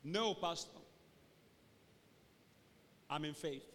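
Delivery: quiet, downcast, halting reply; mock glum insistence. Joyless church member answering the pastor, glumly acted. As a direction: claim faith while sounding defeated.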